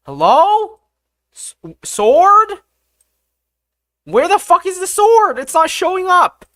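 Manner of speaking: high, loud exasperated shout; frustrated yelling. Real, not acted. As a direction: yell it out, fed up. Frustrated yelling for the missing sword.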